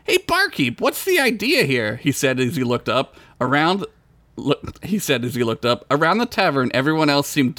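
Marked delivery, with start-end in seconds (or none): 0.0-2.0 high, put-upon little voice; a squeaky hero demanding answers; indignant Bilbert. Acted. Bilbert confronts the barkeep.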